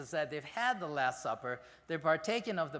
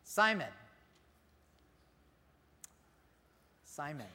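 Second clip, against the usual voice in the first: tender plea. Shown as a demonstration. Jesus pleading tenderly with Simon, voice falling away. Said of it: "I think he said it like this".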